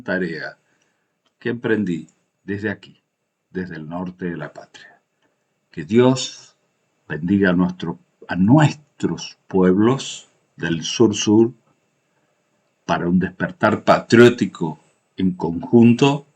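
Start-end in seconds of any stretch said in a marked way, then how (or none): 5.7-16.3 booming balcony speech. Real, not acted. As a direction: declaim it from a balcony. Closing blessing to southern peoples.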